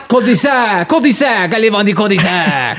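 hammed-up French with a laugh in it; over-the-top French accent. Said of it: "you got any French accents".

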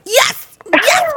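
high, eager squeals; excited yes. Retold or imitated. Client acting out her delight at the sample, squealing. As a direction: squeal both yeses way up high.

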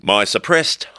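usual speaking voice

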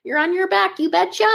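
high, hammed-up Wisconsin instructor voice; Wisconsin accent. Cohost mimics the yoga instructor in a Wisconsin accent. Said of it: "With a Wisconsin twinge of an accent".

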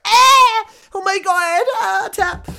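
very high, loud pained yelps; panicked tapping out. Retold or imitated. Getting his leg snapped on the mat, crying out to tap. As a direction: cry out in mock agony while tapping. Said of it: "I'd be like".

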